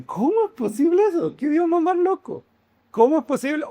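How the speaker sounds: high incredulous outburst; mock listener disbelief. Preacher voicing congregation's disbelief at Greek, high and incredulous. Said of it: "Ustedes dicen".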